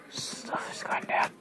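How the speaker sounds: breathy whispered aside; hushed whisper to himself. Real, not acted. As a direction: drop to a whisper. Quietly talking to himself while playing.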